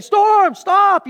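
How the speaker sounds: high loud shout; mock yelling at the storm. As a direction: bark at the storm to stop. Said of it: "It's not you yelling at, at, at the storm".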